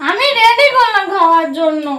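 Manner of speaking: high, loud indignant shout; furious at food taken; angry complaint. Real, not acted. (রেগে চেঁচিয়ে অভিযোগ)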